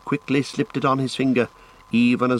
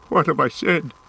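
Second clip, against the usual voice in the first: higher-pitched, stricken remorse; anguished cry; 5 semitones higher than usual. A narrator's reading. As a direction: cry out in dismay at yourself. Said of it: "he cried".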